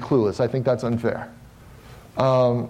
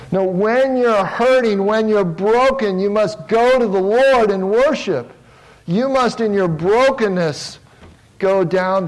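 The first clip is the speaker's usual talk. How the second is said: higher, louder, slow pleading exhortation; fervent preaching; 7 semitones higher, 4 dB louder than usual. Acted. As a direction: drive it home to the congregation. Preaching climax at full force.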